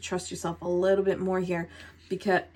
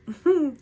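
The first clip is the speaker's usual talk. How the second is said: short high chuckle; brief laugh to oneself; small laugh; 8 semitones higher, 3 dB louder than usual. Real, not acted. Reader looking over the cards, small laugh.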